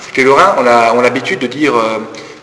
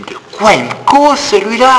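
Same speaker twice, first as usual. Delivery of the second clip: high, louder puzzled mock aside; baffled aside; 10 semitones higher, 4 dB louder than usual. To friends, retelling not understanding Mathieu, high baffled aside. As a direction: sound completely lost by the strange word. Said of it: "mais je dis".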